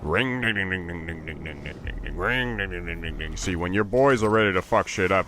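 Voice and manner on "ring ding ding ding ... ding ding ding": low buzzing "ring ding" revving sounds; chainsaw revving noise. Retold or imitated. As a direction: rev like a chainsaw, softly. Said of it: "everybody starts revving their chainsaws".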